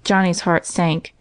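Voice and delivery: plain reading voice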